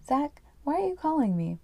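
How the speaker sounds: a hint of an upset wife on the phone; lightly voiced wife. Voicing Pia on the phone.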